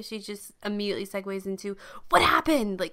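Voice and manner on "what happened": sharp high urgent question; urgent demand. Retold or imitated. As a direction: demand answers right now. Leia demanding information.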